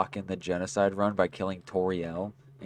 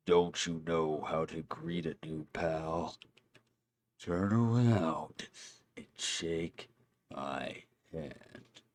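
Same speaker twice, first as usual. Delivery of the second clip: low lazy Sans voice; 3 semitones lower, 3 dB quieter, much slower than usual. Acted. Voicing Sans in a game.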